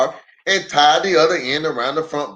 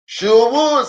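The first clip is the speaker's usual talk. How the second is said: high, louder eager agreement; eager yes; 9 semitones higher, 4 dB louder than usual. Acted. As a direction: jump at the invite.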